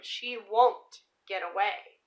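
ordinary delivery, nothing marked

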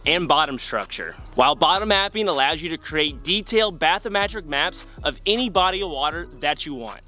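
polished sponsor plug; sponsor ad read. Acted. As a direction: sell it to the viewers with lift on key words.

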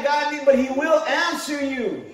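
normal talking voice

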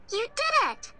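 usual speaking voice

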